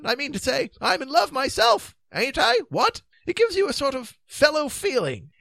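high-pitched, earnest dithering king; high voice of a fretful old king. Acted. King pleading for the lovesick beast, high and dithering.

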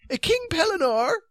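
high, definite, formal character voice; firm and high-pitched; Sir Palomides. A narrator's reading. Knight giving the king notice, high and definite. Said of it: "said Sir Palomides definitely".

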